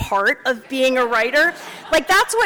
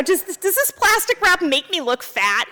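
mild neurotic chicken; 7 semitones higher than usual. Pretend.

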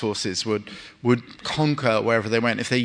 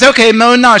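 high, loud mock order; loud command; 13 semitones higher, 12 dB louder than usual. Husband tells his wife in bed to moan now, high and loud. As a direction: bark the order like an eager husband.

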